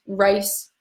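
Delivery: normal talking voice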